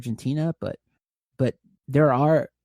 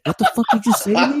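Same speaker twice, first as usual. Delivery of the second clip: mock outrage; 10 semitones higher, 4 dB louder than usual. Shocked reply, higher and laughing.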